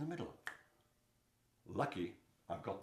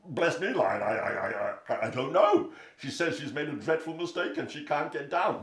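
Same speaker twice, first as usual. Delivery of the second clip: flustered elephant; 5 semitones higher, 8 dB louder than usual. Acted. Elephant answers Lion, flustered.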